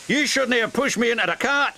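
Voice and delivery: high-pitched, huffy accusation; indignant huff. A narrator's reading. Donald blames his twin for the crash, huffy. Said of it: "huffed Donald".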